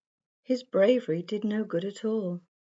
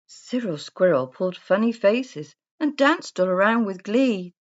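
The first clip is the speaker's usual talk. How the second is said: usual speaking voice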